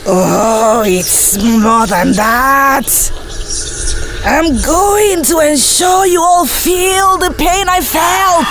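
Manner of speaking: slow, drawn-out menacing voice; vengeful possessed ghost. Acted. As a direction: stretch the words out as an eerie threat.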